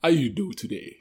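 put-on a playful, half-hearted African accent. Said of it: "Oh, you got the African accent".

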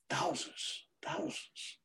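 hushed, voiceless whisper; awed whisper. Real, not acted. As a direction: whisper it in wonder, each word set apart. Awed at a sea of souls.

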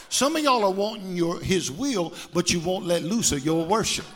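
loud, driving sermon voice with music under it; full force preaching. Acted. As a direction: preach it hard to the whole church.

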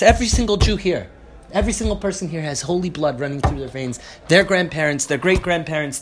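loud, high, indignant outburst ending in shouts; outraged rabbi rebuking a missionary; angry yelling. Retold or imitated. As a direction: rage at the insult to the martyrs. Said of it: "And he yells at them".

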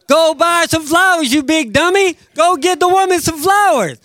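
playful shout at the husband; mock scolding. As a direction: call him a big dummy, high and loud.

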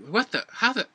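high, baffled put-on turtle voice; a startled turtle who can't believe where it is; confused turtle voice. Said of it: "just goes like".

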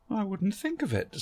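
wondering aloud how long she's been here; lightly voiced Barbara. A narrator's reading.

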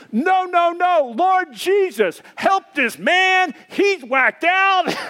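out-pray the madman at full voice; shouted counter-prayer. Retold or imitated. Preacher in a parking lot praying back at the stranger, high slow shout.

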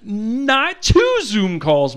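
slow stretched complaint. Retold or imitated. Mock complaint, stretched words.

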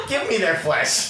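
loud, high, laughing mock-monster demand; mock cannibal. Game master riffing on cannibal joke, loud mock-monster voice. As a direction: play a hungry flesh-eater for laughs.

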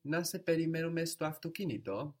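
Να σε περιμένουμε στο αυτοκίνητο lifted high as a question, rising at the end; question intonation. Shown as a demonstration. Teacher models "should we wait for you in the car", question intonation. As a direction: ask Να σε περιμένουμε στο αυτοκίνητο with pitch climbing on αυτοκίνητο. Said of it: "we must make a question intonation".

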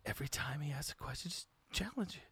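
hushed conspiratorial whisper. Acted. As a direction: scheme quietly out of earshot. Whispered scheming aside.

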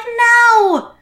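very high, incredulous no; emphatic denial. Retold or imitated. Answering police who ask if he knows her, aghast. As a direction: pitch it way up, aghast at the question.

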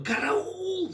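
high loud scream; scream for help. Acted. Thrown man screaming.

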